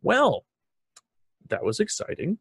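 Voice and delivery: sarcastic bright exclamation; exaggerated wry well; mock excitement. Real, not acted. Host reacts after call.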